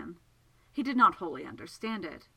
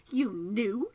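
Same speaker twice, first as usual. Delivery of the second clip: shocked question, a little louder; aghast; 2 semitones higher, 4 dB louder than usual. Acted. Segundus learns Childermas knew it was his dream, aghast. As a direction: ask it in appalled disbelief, pitch rising.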